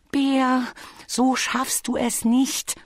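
high, light bee voice; little bee character. Acted. Bee reminds Bear what bears can't do, cautioning. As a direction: voice a small bee, patiently cautioning.

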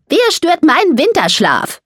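high-pitched, cross calling out; indignant call. A narrator's reading. Mole challenges whoever disturbs his winter sleep, calling out indignantly. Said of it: "rief Samuel".

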